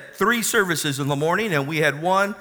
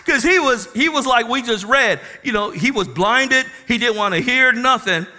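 loud, high, driving preacher's cry; full-force preaching; 5 semitones higher, 5 dB louder than usual. Acted. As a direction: preach it out to the congregation at full volume. Preaching the testimony climax.

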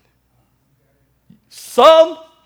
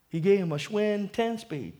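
usual speaking voice

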